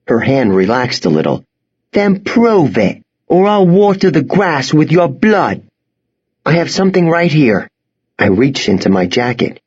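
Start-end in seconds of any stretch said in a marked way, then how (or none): 1.9-5.6 menacing threat. Acted. Girl threatens. 6.5-7.7 hurried. Acted. Boy hurries.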